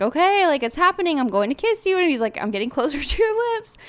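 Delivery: put-on excited boyfriend. Boyfriend voiced leaning in.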